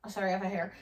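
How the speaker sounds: normal talking voice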